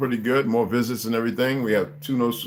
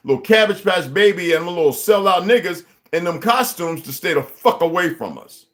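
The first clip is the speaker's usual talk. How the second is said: raised, loud, heated tirade; higher and louder street-corner fury; angry rant; 5 semitones higher, 4 dB louder than usual. Real, not acted. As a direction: preach it hard at the crowd, fired up. Heated rant about banks and unity.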